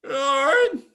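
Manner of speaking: loud, high call across a room; calling from far away. Real, not acted. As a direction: shout the name to reach her. Teacher calling an absent student.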